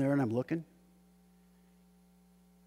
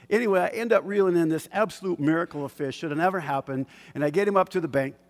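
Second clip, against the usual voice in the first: ordinary delivery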